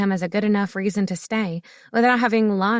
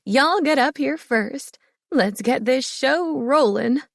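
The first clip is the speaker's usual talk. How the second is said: higher voiced elder proclaiming; old woman elder; 5 semitones higher, slower than usual. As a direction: announce it like the pack elder. Elder calls first table up.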